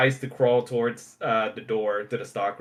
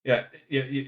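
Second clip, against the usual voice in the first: ordinary delivery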